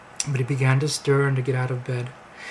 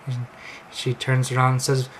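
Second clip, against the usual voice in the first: ordinary delivery, nothing marked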